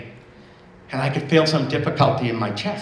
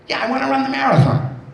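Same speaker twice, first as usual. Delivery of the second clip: put-on eager girl; 11 semitones higher than usual. Girl eagerly wants to run.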